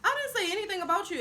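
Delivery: high, quick defensive retort; defensive denial. Retold or imitated. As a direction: retell a fast, high-pitched protest. Retold denial to an accuser.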